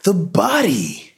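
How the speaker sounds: high, drawn-out frantic cry; shouting. A narrator's reading. Shaken man to neighbors about the body, shouting. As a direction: shout it in panic, high and slow. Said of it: "Ed shouted".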